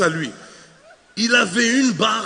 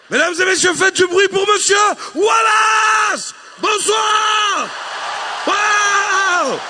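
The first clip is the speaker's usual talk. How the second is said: higher, louder, slow hype shout; self-announced entrance; 10 semitones higher, 4 dB louder, much slower than usual. Acted. Comedian introducing himself to the crowd, loud hype shout. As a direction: announce yourself like a stage host. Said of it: "aller m'accueillir moi-même".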